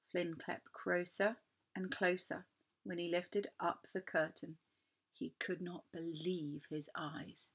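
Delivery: quiet creeping suspense; hushed. A narrator's reading. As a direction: drop to a hush. Creeping toward the hidden thing.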